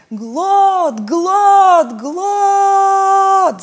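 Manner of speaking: building high loud shout; yelling together. A narrator's reading. Friends yell the island's name with all their strength, building shout. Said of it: "заорали друзья".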